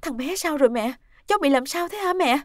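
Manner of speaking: panicked questioning. Acted. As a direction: beg to know what happened to the child. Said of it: "Nguyệt hốt hoảng hỏi mẹ".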